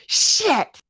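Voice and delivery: high-pitched little swear; kid swearing. Retold or imitated. Niece and nephew dropping something, childish blurted curse. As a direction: blurt it like a child copying dad. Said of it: "they'd drop something and go".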